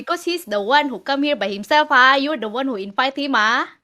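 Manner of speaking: loud Malay English protest. Pretend. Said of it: "Tuh, tuh bisa tuh Singlish".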